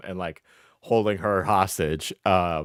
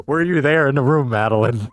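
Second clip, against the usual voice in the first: much louder, pressing the question; loud challenge; 4 semitones higher, 11 dB louder than usual. Real, not acted. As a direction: demand it loudly at her. Challenging the critic directly.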